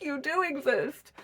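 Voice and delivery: high, slow and hushed, as if meeting a unicorn; awed disbelief. Retold or imitated. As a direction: breathe it out in wonder. Masculines meeting the feminine, awed.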